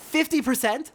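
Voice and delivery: Fifty percent? asked high and loud, rising in disbelief; incredulous. Acted. Frey repeats the merchant's demand, rising disbelief. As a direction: question the number as if you misheard.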